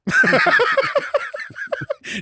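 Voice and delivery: shrill wheezing laugh; high squealing laugh. Real, not acted. Husband squeal-laughing at his own story.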